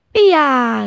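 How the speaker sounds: a high, loud cry to start the race; mother bird calls out to start the race, loud; shouted start. A narrator's reading. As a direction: launch the race with a call. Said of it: "gridò la mamma".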